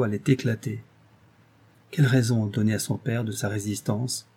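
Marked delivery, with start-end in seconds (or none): none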